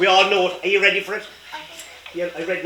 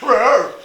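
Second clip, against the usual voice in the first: a louder playful roar that falls away; lion roar; 4 semitones higher, 6 dB louder than usual. Acted. The lion roaring at Maggie, playful roar. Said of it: "And the lion said".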